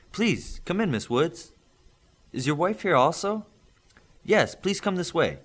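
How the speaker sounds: a hint of a courteous parent; welcoming parents. Parents welcoming the union official, lightly voiced. Father and mother welcome visitor.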